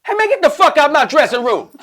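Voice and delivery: yelling get out of my dressing room; loud mock outburst; mock angry yell. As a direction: bark it like an annoyed star. Jimmy yelling him out.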